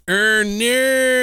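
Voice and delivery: high, drawn-out Australian "oh, no"; Australian accent. Shown as a demonstration. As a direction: show her how the Australian accent goes. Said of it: "It's fucking Australian".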